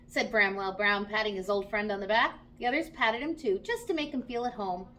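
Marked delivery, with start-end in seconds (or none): none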